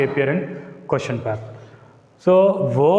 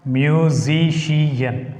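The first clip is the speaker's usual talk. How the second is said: "Musician" slowly split into long, even syllables; much slower than usual. Shown as a demonstration. Teacher breaking a word up for students, slow and divided. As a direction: draw out "Musician" as mu-si-ci-an.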